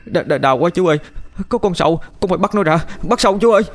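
high, stuttering cries of pain; pained panic. A narrator's reading. Boy in pain clawing at worms.

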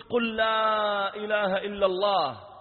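loud, slow, drawn-out urging; high, stretched-out insistence; insistent plea. Retold or imitated. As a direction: call it out to someone slipping away. Repeating plea to dying youth.

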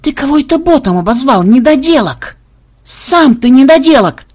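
high loud furious yelling; furious retort. Acted. The double yelling at Xenobyte for calling him a bot, furious and loud. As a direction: bark it back in a rage. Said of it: "тут же побагровел его двойник".

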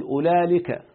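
أولئك said as "ulaalika", with lam replacing hamza; wrong lam form. Shown as a demonstration. Teacher warning students off a form, demonstrating wrong "ulaalika". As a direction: say أولئك with an L before the final "ika". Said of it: "لا تقل".

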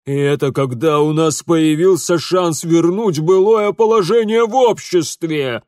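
loud lament climbing high; despairing outburst. Acted. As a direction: wail at the lost chance, building. Father despairs, building to a shout.

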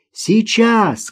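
put-on high-pitched girl's call; girl calling back. A narrator's reading. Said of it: "крикнула в ответ девочка". (девочка кричит в ответ)

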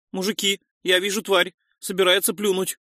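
high-pitched urgent call; shouted warning. A narrator's reading. Noise warns the men the creature will spit, shouting. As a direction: call out an alert, pitched well up. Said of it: "крикнул Нойс".

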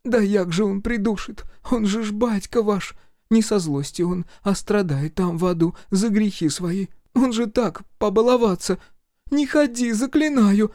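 высокий умоляющий голос матери, нарастает к концу; заклинает сына не ходить, всё громче. A narrator's reading. Mother voiced high, pleading. (высокая мольба матери, громче к концу)

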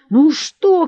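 high, loud challenge; defensive shout. A narrator's reading. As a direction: shout it at the mob, standing your ground. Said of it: "кричал Ермолай".

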